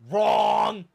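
loud, drawn-out buzzer-style "wrong"; mock emphatic shout. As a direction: call it out loud like a wrong-answer ruling. Mock game-show correction of himself.

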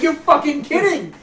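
slow, high, loud; disbelieving. Retold or imitated. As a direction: stretch out the disbelief. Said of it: "I'm like".

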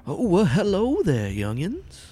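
put-on high, drawn-out elderly greeting; kindly surprised old man. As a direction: greet them as a startled, friendly old-timer. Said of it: "kinda shocked to see you guys walk in".

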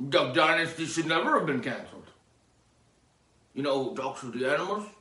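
ordinary talk

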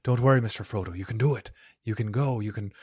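lightly voiced loyal Sam, cheering a friend on; a hint of Sam Gamgee's gentle encouragement; Sam impression. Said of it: "That's my Sam impression".

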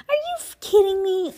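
high, loud outburst of disbelief; real disbelief. Real, not acted. Reader reacting to the plot in disbelief. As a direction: blurt it out in real shock. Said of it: "Oh my God".

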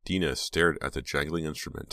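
usual speaking voice

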